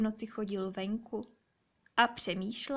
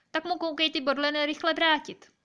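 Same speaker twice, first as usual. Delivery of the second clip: higher, louder owl telling the magpie off; insistent storybook reprimand, raised voice; stern scolding order; 6 semitones higher, 5 dB louder than usual. Acted. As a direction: order the culprit to give it back at once. Owl orders magpie to return spines.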